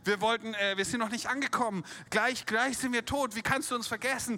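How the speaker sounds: hammered-up cry of a frightened leader; desperate cry to God. Retold or imitated. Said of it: "hingeschrien zu Gott".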